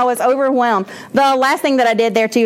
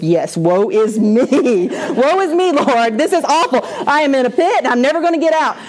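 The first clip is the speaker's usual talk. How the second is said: melodramatic lament; 3 semitones higher than usual. Retold or imitated. Teacher lamenting to the Lord in Psalms, mock melodramatic wail. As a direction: ham up the self-pity. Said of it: "You're like".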